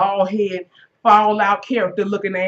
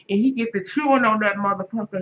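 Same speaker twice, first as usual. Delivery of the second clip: normal talking voice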